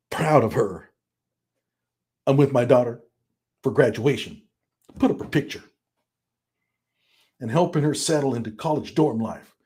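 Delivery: a hint of choppy bursts, each phrase stressed; lightly imitated short-burst delivery; short emphatic bursts. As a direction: read it in little short bursts, every sentence punched. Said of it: "And yes, he talks like this".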